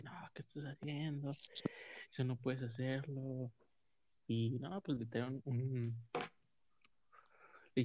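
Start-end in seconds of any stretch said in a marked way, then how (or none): 0.0-3.5 whispered scolding. Retold or imitated.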